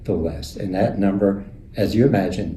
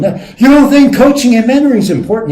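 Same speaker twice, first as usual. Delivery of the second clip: much higher, loud, fast outraged yell; imitated screaming; 10 dB louder, faster than usual. Other CEOs screaming at John over coaching, loud outraged yell. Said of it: "He just starts screaming at poor John".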